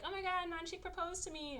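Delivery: high-pitched gush, mildly acted; excited gushing. Woman tells the half-brother of a proposal, excited gushing. Retold girl sharing proposal news.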